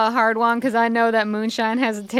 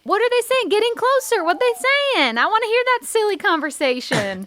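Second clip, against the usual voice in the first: eager urging; 10 semitones higher than usual. Acted. Player eagerly urging gull.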